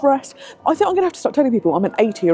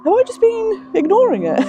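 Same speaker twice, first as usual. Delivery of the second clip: laughing; 12 semitones higher than usual. Real, not acted. Laughing at own obliviousness.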